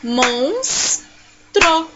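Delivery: MONS, long pause, TRO; syllable by syllable. Shown as a demonstration. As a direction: split MONSTRO into two slow beats. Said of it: "separe as sílabas dessa palavra".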